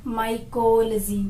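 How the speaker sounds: माइकोलॉजी said slowly with the o before logy dropped, mai-kol-ji; correct pronunciation. Shown as a demonstration. Teacher models for students, माइकोलॉजी slowly as mai-kol-ji. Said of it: "तो इसको भी हम क्या बोलेंगे?".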